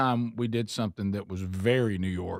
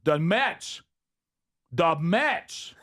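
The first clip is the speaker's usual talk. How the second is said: disgusted New York fan, pitched up; put-on New York accent; mock New York accent; 5 semitones higher, much slower than usual. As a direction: spit out the team name like a New Yorker. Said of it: "I started talking like New Yorkers".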